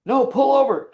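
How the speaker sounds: high, loud mock yell; retold angry cursing. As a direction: insist urgently, imitating a frightened passenger. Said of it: "he started cursing at me and saying".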